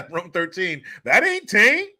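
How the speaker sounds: usual speaking voice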